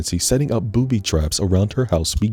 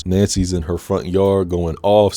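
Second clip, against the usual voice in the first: ordinary talk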